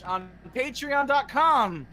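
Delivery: slow, drawn-out announcer delivery; hammed-up commercial plug; fake ad read. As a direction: stretch it like a parody pitchman. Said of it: "time for our fake ad of the day".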